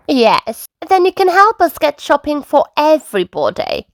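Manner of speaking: a hint of a keen grandmother; Granny Pig. A narrator's reading. Granny to grandchildren about shopping, lightly high voice. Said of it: "Granny Pig said".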